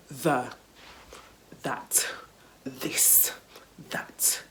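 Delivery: "the, that, this, that" word by word, with th; low, separated drill of "the, that, this, that"; practising th. Retold or imitated. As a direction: carefully sound th in "the, that, this, that", pausing between. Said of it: "had to go home and be like".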